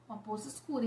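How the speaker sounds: a dropped, hushed echo of the phrase; hushed repeat. Retold or imitated. Dramatic repeat of dark pool.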